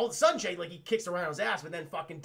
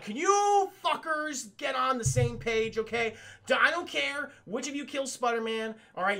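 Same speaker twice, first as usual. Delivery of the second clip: acted exasperated boss; 6 semitones higher than usual. Kingpin to the arguing Insidious Six, mock exasperated scolding. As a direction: scold squabbling underlings, hammed-up impatience.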